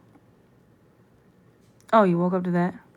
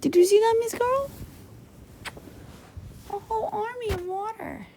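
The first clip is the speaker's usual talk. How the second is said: excited; 8 semitones higher, 9 dB louder than usual. Real, not acted. Excited about dog drinking.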